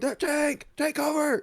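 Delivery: high, loud urgent shout; yelled warning. Retold or imitated. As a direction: holler a warning to everyone around you. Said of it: "I yell at everyone".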